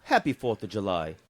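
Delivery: Australian-British accent, lightly put on; a put-on Aussie-Brit holiday greeting; mixed Australian and British accent. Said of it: "you mixed the two accents together".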